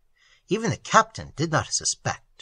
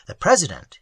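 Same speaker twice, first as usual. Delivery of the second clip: ordinary delivery, nothing marked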